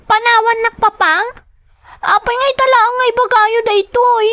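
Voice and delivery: put-on a little girl hurt that papa is leaving; upset child. Child protests father leaving her behind.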